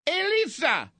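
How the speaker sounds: very high, loud Irish call of a name; Irish accent, calling the manager. Retold or imitated. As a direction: shout the name in mock Irish. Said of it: "what accent was that?".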